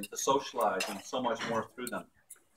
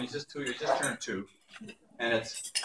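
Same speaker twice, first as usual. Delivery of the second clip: ordinary delivery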